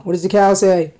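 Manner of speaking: ordinary talk, nothing marked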